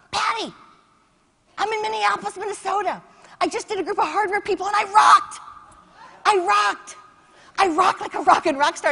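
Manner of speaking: high, loud, breathless boasting; excited bragging. Retold or imitated. Speaker calling her sister after the gig, thrilled shouting. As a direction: shout the news like a rock star.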